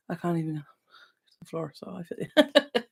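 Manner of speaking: whisper. Real, not acted. Whispered confiding aside to viewer.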